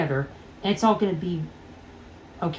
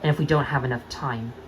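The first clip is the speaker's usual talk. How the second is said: normal talking voice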